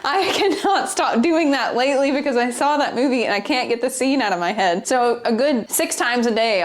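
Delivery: usual speaking voice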